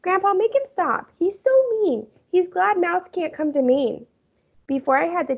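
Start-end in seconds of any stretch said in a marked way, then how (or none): 0.0-4.1 put-on high, whining young girl voice; whiny girl. A narrator's reading. Sheila whining to Grandpa about Peter. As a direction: complain to Grandpa in a whine.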